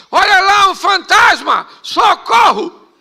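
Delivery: high, loud cry for help, acting out terrified men; gritando apavorado. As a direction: yell it out as a panicked alarm. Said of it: "começaram a gritar". (gritos de susto e socorro)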